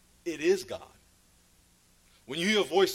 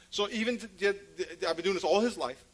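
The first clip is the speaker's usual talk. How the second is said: ordinary delivery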